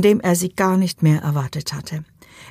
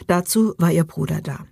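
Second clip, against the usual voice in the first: plain reading voice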